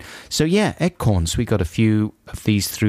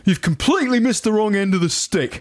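high-pitched put-on character voice; blustering office chap; 8 semitones higher than usual. Reading an office chap's malapropism, high put-on voice. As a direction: play the muddled colleague in earnest.

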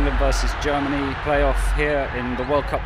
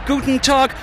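ordinary talk

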